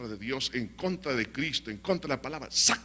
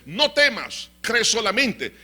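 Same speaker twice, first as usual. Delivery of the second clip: high, loud, emphatic command; rousing exhortation; 6 semitones higher, 6 dB louder, slower than usual. Acted. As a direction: proclaim it as a challenge to fear. Same words preached as rousing challenge. (exhortación fuerte, aguda y enérgica)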